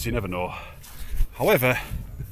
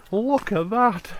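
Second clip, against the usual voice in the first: awed; 12 semitones higher, much slower than usual. Real, not acted. Awed by mountain view.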